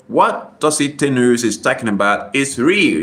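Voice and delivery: normal talking voice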